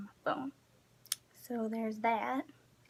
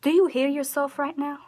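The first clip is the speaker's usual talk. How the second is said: louder, higher disbelieving jab; exasperated retort; 4 semitones higher, 6 dB louder than usual. Retold or imitated. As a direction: call out the commenter, fed up. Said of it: "I'm like".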